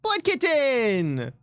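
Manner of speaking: slow, drawn-out high call. Real, not acted. Streamer hailing a supporter by name, calling out.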